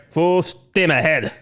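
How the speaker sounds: slow dramatic captain's command; drawn out like a starship captain; slow captain's order. Pretend. Host giving the navigator a slow dramatic order. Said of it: "William Shatner".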